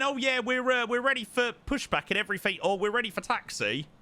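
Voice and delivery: high, quick, breezy self-imitation; mock your own false confidence; mock confident pilot. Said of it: "Here's me saying".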